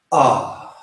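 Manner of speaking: a held-back sigh of "ah"; hesitant "ah". Shown as a demonstration. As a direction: say "ah" while hesitating to let go. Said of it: "here's what hesitation looks like".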